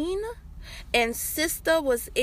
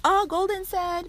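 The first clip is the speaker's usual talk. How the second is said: higher, mocking second-hand report; mock quoting; 9 semitones higher than usual. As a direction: parrot someone repeating what they heard, pitched way up. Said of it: "So don't be going like".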